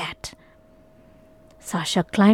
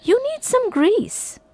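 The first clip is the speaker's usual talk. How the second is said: put-on high girl voice; 14 semitones higher than usual. A narrator's reading. Sasha talks to the gate.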